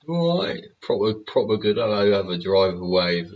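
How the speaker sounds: buzzing with excitement over the wave; thrilled. Real, not acted. Thrilled the driver waved back.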